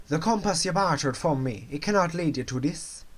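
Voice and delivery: badly faked, hammed-up Jamaican accent; amateur Jamaican accent; terrible Jamaican accent. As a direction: play the film character with a clumsy Jamaican accent. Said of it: "That was the world's worst Jamaican accent".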